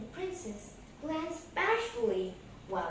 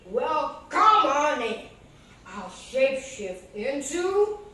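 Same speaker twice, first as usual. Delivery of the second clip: much louder booming ogre voice with laugh; 11 dB louder than usual. Acted. Ogre greeting the little cat, booming. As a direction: boom like a big jolly ogre. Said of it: "the ogre opened the door".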